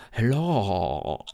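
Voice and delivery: low haunting ghostly hello. Retold or imitated. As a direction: breathe it out like a ghost. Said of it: "they all sound like a ghost".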